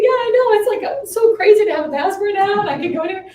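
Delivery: high, loud gushing; excited. Retold or imitated. New passport holder gushing to client, excited. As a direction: reenact being giddy with excitement. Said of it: "I was so excited".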